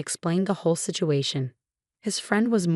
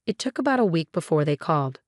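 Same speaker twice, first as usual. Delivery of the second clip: ordinary delivery, nothing marked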